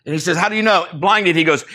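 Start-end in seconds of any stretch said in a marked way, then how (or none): 0.4-0.9 loud, high challenging question; acted challenge. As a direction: demand proof, voice raised and pitched up. Officer challenges blinded trainer.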